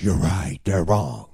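agitator's voice, building to loud indignation; Satan. Acted. Agitator in your ear stirring a quarrel, rising indignant outrage. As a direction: egg them on, outraged on their behalf. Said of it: "the whisperer and the agitator is Satan".

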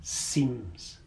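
"seems" set apart, low and soft; weight "seems" by dropping it low after a pause; stress on seems. Shown as a demonstration. Preacher qualifying believers' experience, low stress on "seems". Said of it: "I want to stress that word".

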